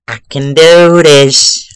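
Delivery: loud, slow self-pep talk; determined. Real, not acted. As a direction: psych yourself up, drawn out. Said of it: "Okay, I can do this".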